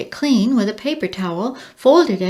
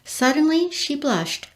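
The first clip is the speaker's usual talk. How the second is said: ordinary delivery, nothing marked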